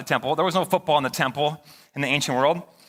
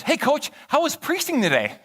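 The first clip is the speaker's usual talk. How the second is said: lightly voiced young boy calling out, higher; imitating a kid; 9 semitones higher than usual. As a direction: chirp it like a curious kid. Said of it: "He goes".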